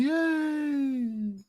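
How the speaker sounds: high, soft mock shout; imitated cheer. Streamer echoing the mum in the video, mock cheer. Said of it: "There's Mum shouting".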